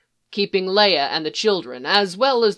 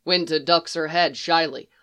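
usual speaking voice

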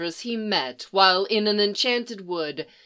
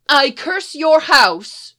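higher, louder, slow pronouncement; solemn curse; 6 semitones higher, 8 dB louder, slower than usual. Acted. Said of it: "she starts".

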